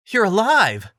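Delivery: high, loud joyful cry; relieved exclaim. A narrator's reading. Link reaching the wizard after the collapse, relieved cry. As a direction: burst out with relief. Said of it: "exclaimed while catching his breath".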